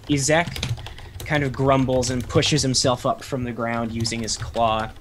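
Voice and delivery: ordinary talk, nothing marked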